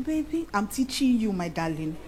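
soft coaxing darling voice, fading low; lightly sweeten it for your husband; sweet changed voice. Shown as a demonstration. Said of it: "I'll just change my voice next time".

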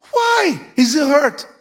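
ordinary talk

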